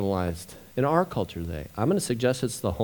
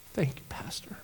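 hushed, knowing little whisper; 11 dB quieter than usual. Retold or imitated. Desk clerk thanking the pastor, in a knowing whisper. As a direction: breathe it like a quiet shared secret.

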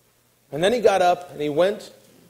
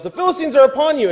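high, loud, rapid warning cry; urgent shouted warning, pitched way up; sudden yell; 11 semitones higher, 8 dB louder, faster than usual. Acted. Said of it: "all of a sudden she yells".

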